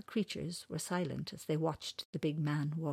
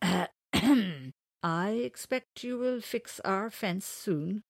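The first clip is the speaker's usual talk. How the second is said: slow, higher owl voice; wise old owl; 4 semitones higher, 4 dB louder, much slower than usual. Acted. Owl tells man to fix the fence, wise old owl. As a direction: draw it out as a stately old owl. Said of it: "said the wise old owl".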